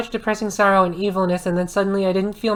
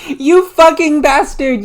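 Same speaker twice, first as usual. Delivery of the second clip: loud, higher, slow yell; 7 semitones higher, 8 dB louder, slower than usual. A narrator's reading. Draco yelling at Vampire, loud and slow. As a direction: yell it at him, furious. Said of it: "yelled Draco".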